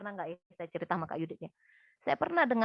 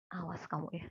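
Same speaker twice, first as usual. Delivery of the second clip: a low, hushed warning, softer than her talk; quiet threat; 3 semitones lower, 9 dB quieter than usual. Retold or imitated. Student grabbing a classmate's collar, quiet hushed threat. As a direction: threaten him quietly so the lecturer won't hear. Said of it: "Saya ngomong pelan".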